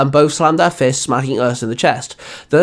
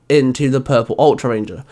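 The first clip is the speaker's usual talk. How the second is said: ordinary delivery, nothing marked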